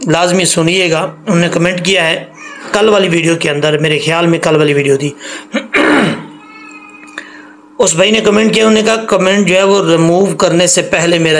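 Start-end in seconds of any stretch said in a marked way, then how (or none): 5.1-6.2 clearing the throat; a noisy clearing of the throat mid-lesson. Real, not acted. Clears throat between remarks.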